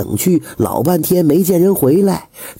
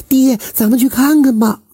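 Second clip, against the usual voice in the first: put-on high, pleading girl's voice; a daughter tugging her father's hand; eager young daughter; 11 semitones higher than usual. Daughter urging her father to go, high and pleading. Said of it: "赶紧拉着财主的手就说".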